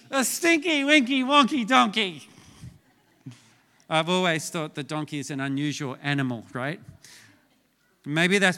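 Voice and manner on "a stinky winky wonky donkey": hammed-up punchline. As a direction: sell the dad joke big. Dad joke punchline.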